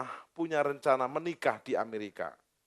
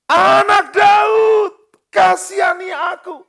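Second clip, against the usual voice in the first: high, loud desperate cry; 17 dB louder, much slower than usual. Acted. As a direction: beg at the top of your voice. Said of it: "dia semakin keras berseru". (berseru keras memohon belas kasihan)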